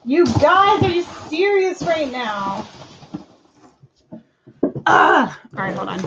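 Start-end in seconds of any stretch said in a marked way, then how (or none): 0.1-2.6 can't believe this, you guys; exasperated. Real, not acted. Item missing, exasperated at store. 4.9-5.3 frustrated groan. Real, not acted. Frustrated at missing item.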